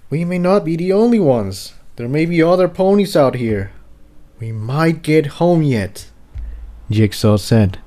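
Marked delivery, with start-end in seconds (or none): 0.1-6.1 hopeful excitement. Acted. Jigsaw excited at the news.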